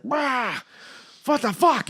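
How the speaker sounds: high-pitched startled outburst; outraged puppet. Acted. Puppet released from the cooler, high outraged cry. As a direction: burst out high and indignant.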